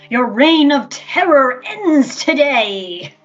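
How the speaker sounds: slow, loud heroic declaration; bold heroic voice. Acted. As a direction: proclaim it like a brave hero. Hero challenges the queen.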